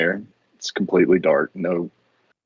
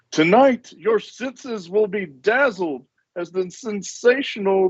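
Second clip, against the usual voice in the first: ringmaster hype; 11 semitones higher than usual. Acted. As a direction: hype the crowd over the fireworks. Ringmaster fireworks announcement continues.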